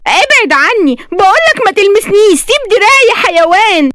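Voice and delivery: very high, indignant cry; acted screaming protest. A narrator's reading. Woman dragged downstairs by her arm, high screaming protest. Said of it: "فكانت بتصرخ فيه وهو بيشدها".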